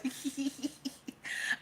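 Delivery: soft, breathy giggle; giggling. Real, not acted. Streamer breaking up during the rant, soft giggle. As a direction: crack up quietly mid-rant.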